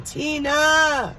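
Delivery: high, drawn-out yell of a name; mom screaming a name. Retold or imitated. Mom calling her kid over the music, imitated scream. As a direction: imitate mom hollering through the house. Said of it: "screaming my name".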